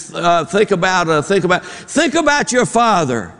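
high-pitched, stammering mock plea; groping for words. Counselor searching for an argument, mock-desperate groping. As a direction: stumble over restarts, then blurt it high. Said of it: "he's trying to think of what to say".

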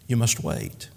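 low, quiet, gentle answer; soft reply. A narrator's reading. The master replies once again to the believer, softly. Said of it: "Then softly and quietly".

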